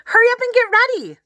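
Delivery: put-on eager ten-year-old girl announcing museum day; excited little sister. A narrator's reading. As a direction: squeal it like an eager kid sister. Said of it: "she said".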